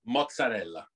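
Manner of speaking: Mozzarella as "mo-tsa-RELL-a" with a tapped r; Italian mozzarella. Shown as a demonstration. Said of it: "How do you pronounce".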